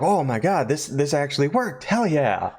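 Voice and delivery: high-pitched amazed celebration; excited. Retold or imitated. Revived performer finds that the trick worked, high excited voice. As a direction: play him thrilled it worked. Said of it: "Ulrich's all excited".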